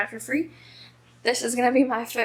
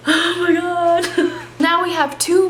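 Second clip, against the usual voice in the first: higher, drawn-out laughing squeal; shocked squeal; 7 semitones higher, 4 dB louder than usual. Real, not acted. Vlogger reacting to a second campus murder, shocked laughing squeal. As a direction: squeal it out in disbelief.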